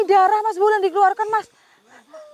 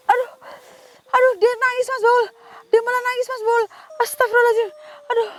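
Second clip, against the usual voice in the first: in pain; 9 semitones higher, faster than usual. Real, not acted. Hurt by the crying doll, moans in pain to Mas Baul. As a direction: groan through the hurt, close to tears. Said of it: "Aduh, saya sakit sekali".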